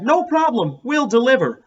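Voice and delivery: high, loud breezy mom; mother's voice. A narrator's reading. Joe imitating his mother's breezy answer. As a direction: toss it off like a cheerful mom. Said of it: "But my mother said".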